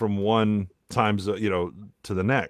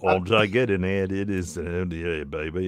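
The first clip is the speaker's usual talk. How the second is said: put-on mixed Australian-Southern accent; 5 semitones lower, 4 dB quieter than usual. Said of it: "I start mixing the fucking accents".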